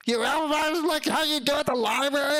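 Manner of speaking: very high, astonished question; delighted stranger can't believe it; incredulous. Retold or imitated. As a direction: squeal it in disbelief. Delivery guy incredulous.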